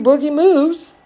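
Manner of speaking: ordinary delivery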